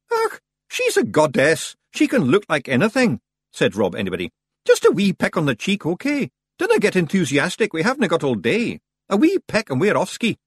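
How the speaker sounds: high, loud Scots pixie voice; brisk wee Feegle giving orders. Acted. Rob Anybody coaches Roland on the kiss, high and brisk.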